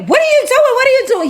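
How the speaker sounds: shrill-up and protest loudly, hammed-up; indignant mock yell. Girl caught as the door kicks open, mock indignant yell.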